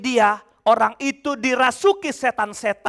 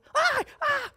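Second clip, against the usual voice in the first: high, far-off yelps of fear; demon's frightened cries; 12 semitones higher, 7 dB quieter than usual. Retold or imitated. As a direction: yelp like a scared demon. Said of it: "Iblis itu teriak-teriak dia". (pekikan iblis yang ketakutan)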